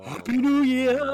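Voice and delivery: high, loud whooping cheer; mock cheer. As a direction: yell it out high and merrily over the laughter. Mock cheer at joke punchline.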